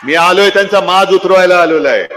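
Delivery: loud repeated battle cry; rally shout. Acted. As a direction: shout each line to the crowd.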